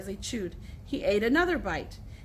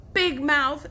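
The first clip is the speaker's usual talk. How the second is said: loud, rising taunt; shouted; 4 semitones higher, 5 dB louder than usual. A narrator's reading. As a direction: snap it out as an insult. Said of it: "shouted Alan".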